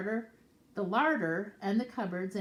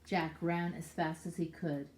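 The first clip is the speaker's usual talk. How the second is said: plain reading voice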